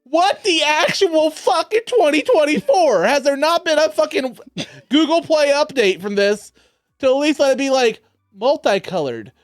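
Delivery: high slow mock indignation; hammed-up ranting disbelief; exaggerated rant. Ranting about lights app.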